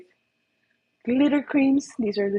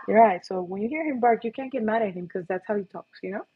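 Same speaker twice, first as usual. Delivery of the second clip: ordinary delivery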